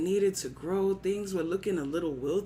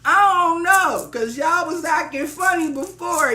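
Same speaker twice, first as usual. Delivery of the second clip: loud, high-pitched mock reluctance; giving a hard time; 7 semitones higher, 9 dB louder than usual. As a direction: play hard to get, louder and higher. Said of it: "had to give him a hard time".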